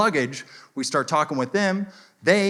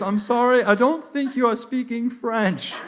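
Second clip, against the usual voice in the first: high, slow, doubtful French guy; shaken confidence; 8 semitones higher, slower than usual. Retold or imitated. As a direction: imitate a Frenchman unsure of his own claim. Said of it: "he said it with the confidence of like".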